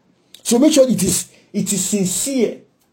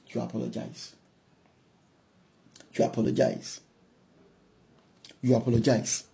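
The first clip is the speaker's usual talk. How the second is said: quiet insistence, each time softer; soft repeated apology; softly repeated; 7 semitones lower, 12 dB quieter than usual. Real, not acted. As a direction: say it low and gently, over and over. Preacher repeats softly for emphasis.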